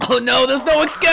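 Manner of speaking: high, loud mock panic. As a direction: cry out in hammy alarm. Sucked into underwater palace.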